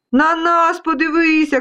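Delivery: high, mild storybook shout; high-pitched little voices calling; calling dust specks. Acted. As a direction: call out for attention. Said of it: "кричали пилинки".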